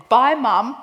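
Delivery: imitating a teenager's breezy shouted goodbye; calling out goodbye. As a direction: call out a gleeful bye, playing it for laughs. Retold teen calling goodbye to mother.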